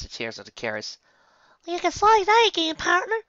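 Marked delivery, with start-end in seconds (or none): 1.6-3.2 very high, loud filly voice; sassy little filly; Apple Bloom voice. Acted. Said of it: "Apple Bloom muttered".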